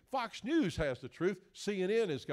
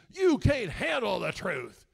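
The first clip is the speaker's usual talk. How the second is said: slow, loud, higher famous movie yell; Jack Nicholson impression; 5 semitones higher, 8 dB louder, slower than usual. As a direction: roar it at the young lawyer, slowly. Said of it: "Jack Nicholson says".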